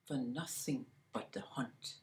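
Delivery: hushed storyteller whisper. A narrator's reading. Storyteller whispers it to the listeners. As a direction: breathe it out as a secret.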